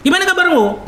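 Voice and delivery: high, sharp, rising-up greeting. Shown as a demonstration. Preacher showing the congregation a tone that goes up. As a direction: push the voice way up as if tense. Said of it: "Beda ya".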